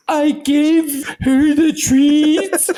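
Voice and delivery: high, very slow sheepish admission; meek girl's confession. Retold or imitated. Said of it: "And she was like".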